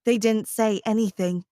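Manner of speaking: usual speaking voice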